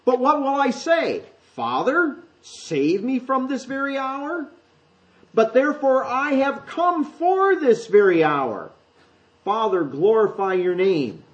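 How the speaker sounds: Jesus' words, high and slow, weighed down; voicing Jesus. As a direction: speak as a troubled Jesus facing the hour. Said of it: "And Jesus says".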